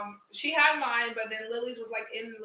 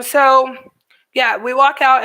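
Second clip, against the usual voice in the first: normal talking voice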